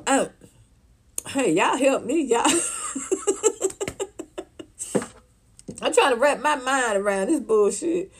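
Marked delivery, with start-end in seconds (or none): 2.5-5.0 airy high laugh, almost silent; a squeaky disbelieving laugh; high breathy laugh. Real, not acted. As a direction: laugh that barely makes a sound. Laughs at the absurdity.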